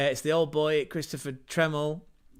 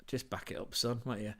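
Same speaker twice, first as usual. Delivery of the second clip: whispered, much softer aside; hushed aside; 3 semitones lower, 7 dB quieter than usual. Acted. Podcaster whispering a joking aside, much softer. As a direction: murmur it to the side.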